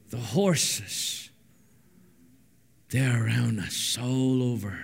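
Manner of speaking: slow, low, measured prayer. Real, not acted. Preacher praying for opened eyes, slow and reverent prayer. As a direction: pray slowly with long pauses.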